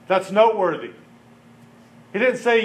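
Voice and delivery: usual speaking voice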